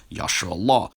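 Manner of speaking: "Yasher Allah" as YAH-sher ah-LAH; claimed pronunciation. Shown as a demonstration. Lecturer reads his tweet on the claim, demonstrated pronunciation. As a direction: pronounce "Yasher Allah" with a broad final AH. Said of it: "claim that this word is pronounced".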